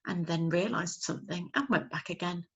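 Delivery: ordinary delivery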